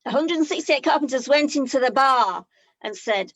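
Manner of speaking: ordinary talk, nothing marked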